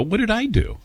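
high, hurt little squeak of disbelief; mock indignant surprise. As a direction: play it wounded and baffled. Indignant retort in retold dinner story.

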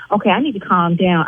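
ordinary delivery

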